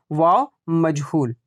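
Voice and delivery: ordinary delivery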